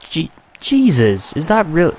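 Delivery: high, loud, stunned Jesus; outraged disbelief. Real, not acted. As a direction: yell at the screen in disbelief. Gamer outraged at a goal.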